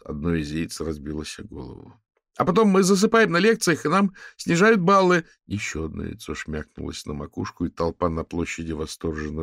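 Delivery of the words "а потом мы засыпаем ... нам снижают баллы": high, loud ringing student voice. A narrator's reading. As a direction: ring out across the square. Student continues his complaint.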